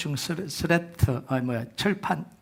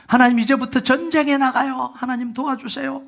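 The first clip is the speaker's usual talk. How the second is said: higher, louder urgent plea to God; pleading prayer; 9 semitones higher, 4 dB louder than usual. Retold or imitated. Israelites before battle calling God, urgent plea. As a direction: cry out like soldiers before battle. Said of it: "나팔을 왜 불어요".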